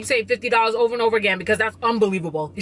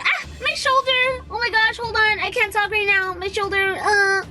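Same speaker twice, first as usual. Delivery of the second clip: much higher, hammed-up pained whimper; mock fake injury; 8 semitones higher than usual. Friend caught lying fakes a shoulder injury, hammed-up pain. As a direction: overplay a sudden shoulder pain to dodge a lie. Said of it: "sometimes she fakes injury".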